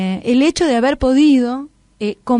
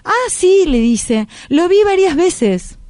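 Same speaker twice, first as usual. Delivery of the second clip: put-on boy's voice; 6 semitones higher, slower than usual. Narrator voices the boy Colton.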